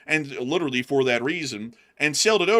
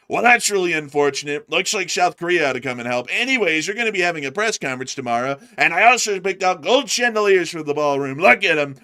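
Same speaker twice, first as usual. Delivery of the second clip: louder, higher breezy brush-off; mock response; 6 semitones higher, 7 dB louder than usual. US leader brushing off the attack in a loud mock voice. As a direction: shrug it all off, showing off. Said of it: "and our response is".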